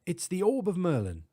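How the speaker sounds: proud English scholar; British accent. Acted. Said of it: "distinct British accent".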